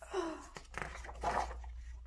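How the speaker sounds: sharp high breathy gasp. Acted. Boy seeing the wolf about to eat Luna, gasping. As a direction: gasp in sudden fright. Hero sees wolf attack friend.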